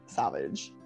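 ordinary delivery

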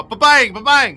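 loud, high ba-bang; hammed-up finger-gun shots; mock gunshots. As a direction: shout out pretend gunfire.

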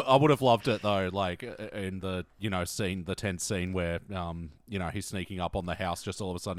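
usual speaking voice

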